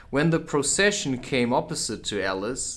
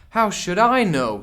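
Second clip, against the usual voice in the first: put-on higher, louder girlish retort; bold Alice; 8 semitones higher, 7 dB louder than usual. Alice answers the Queen back, surprised at her courage. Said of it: "surprised at her own courage".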